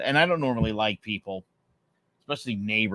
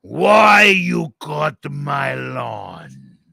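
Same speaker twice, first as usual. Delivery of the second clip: put-on very slow, wailing Italian-accented yell; hammed-up anguished Italian neighbor; 3 semitones higher, 8 dB louder, much slower than usual. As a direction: stretch every word into an Italian-accented lament. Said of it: "I could do the accent".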